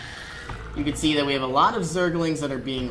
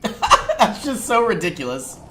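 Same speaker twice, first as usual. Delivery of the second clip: laughing; 8 semitones higher, 4 dB louder than usual. Real, not acted. Laughing at the swarm.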